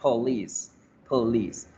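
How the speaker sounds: "police" sounding almost like please, O reduced to uh; schwa in police. Shown as a demonstration. Teacher repeating correct "police", schwa first syllable. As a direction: barely voice the O in "police". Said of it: "casi que suena como a please".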